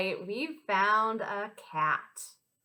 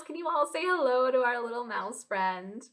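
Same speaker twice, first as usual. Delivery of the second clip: normal talking voice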